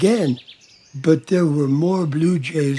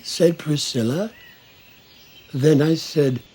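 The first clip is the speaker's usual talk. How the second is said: plain reading voice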